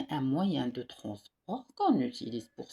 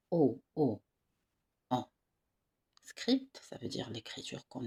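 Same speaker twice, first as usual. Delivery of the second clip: whisper. Real, not acted. Teacher whispers to class.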